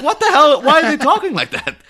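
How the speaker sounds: high, loud mock disbelief with a laugh; baffled outcry. Friends realizing it's set in Canada, laughing loud disbelief. Retold baffled reaction to the film.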